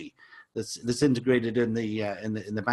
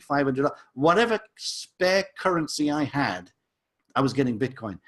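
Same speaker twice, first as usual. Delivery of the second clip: ordinary delivery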